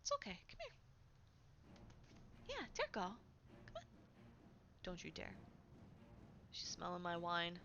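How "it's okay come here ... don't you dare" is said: soft, hushed coaxing, some lines much higher; coaxing the cat. Real, not acted. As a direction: gently call a pet over.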